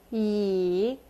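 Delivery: "Yi" dipping low, then rising; third tone. Shown as a demonstration. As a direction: draw out "Yi", down and back up. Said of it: "down and up".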